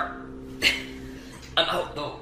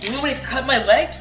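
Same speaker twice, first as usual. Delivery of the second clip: ordinary talk, nothing marked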